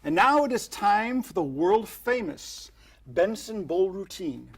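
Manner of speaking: bill it like a stage announcer; announcer intro. Shown as a demonstration. Magician announcing the famous routine, grand announcer style.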